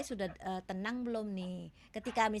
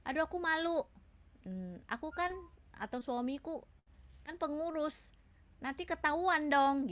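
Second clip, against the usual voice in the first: embarrassed worry; 6 semitones higher than usual. Retold or imitated. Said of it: "di jemaat merasa".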